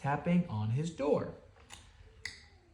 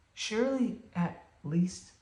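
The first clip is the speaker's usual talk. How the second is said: pleading mouse; 10 semitones higher, 4 dB quieter, slower than usual. Acted. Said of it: "Mouse began".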